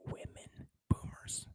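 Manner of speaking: breathy whisper, no voice; whispered aside. Real, not acted. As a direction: lean in and whisper it like a secret. Confiding a touchy opinion.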